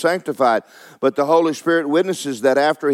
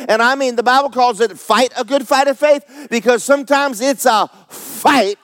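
high, emphatic pulpit delivery; fervent, insistent preacher; full-force preaching; 8 semitones higher, 4 dB louder than usual. Real, not acted. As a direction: drive each question and answer home to the congregation.

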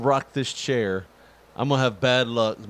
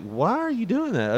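incredulous; 11 semitones higher than usual. Retold or imitated. Confused retort to coworker.

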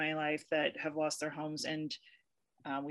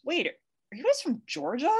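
high, slow, amazed question; much higher and slower, astonished; retold surprise; 10 semitones higher, 4 dB louder than usual. Spotting Atlanta trucks, high slow surprised question. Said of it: "And I'm like".

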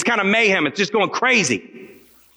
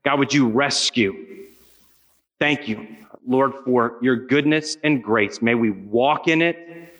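closing prayer; 5 semitones lower than usual. Real, not acted. Preacher prays over students.

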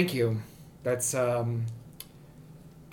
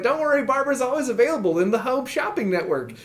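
cheerful salesman voice; 8 semitones higher than usual. Acted. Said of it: "He's like".

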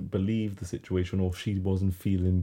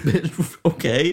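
laughing, high and loud; scoffing through laughter; 10 semitones higher, 8 dB louder, much slower than usual. Retold or imitated. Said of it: "I was like".

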